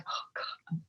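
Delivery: hushed, unvoiced whisper of embarrassment; whispered self-quote. Whispering her own embarrassment at tearing up. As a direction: breathe it out as a whisper. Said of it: "It's like".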